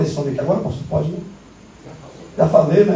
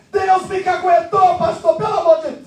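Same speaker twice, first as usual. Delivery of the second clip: high, shouted mock lament; hammed-up pleading cry; panicked wail; 9 semitones higher than usual. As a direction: wail like a man found out. Man wails that God exposed him.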